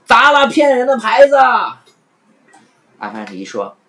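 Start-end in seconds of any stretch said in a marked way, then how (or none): 0.0-1.4 high, loud jeering shout; crowd jeering. Acted. Passers-by jeering at the sign, loud shout. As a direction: rouse the crowd to smash it. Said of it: "路人和乞丐在一旁起哄".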